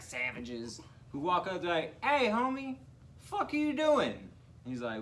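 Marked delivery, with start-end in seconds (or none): 2.0-4.3 very high-pitched, incredulous call-out; high, hammed-up challenge; mock confrontation. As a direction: imitate someone calling out in disbelief. Acting out men confronting someone.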